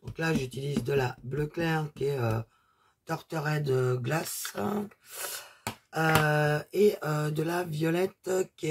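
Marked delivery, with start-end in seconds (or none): none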